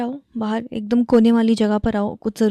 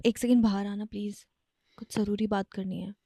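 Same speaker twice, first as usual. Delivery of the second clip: hushed secretive; 3 semitones lower, 8 dB quieter than usual. Acted. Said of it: "जो चिल्ला रहे हो".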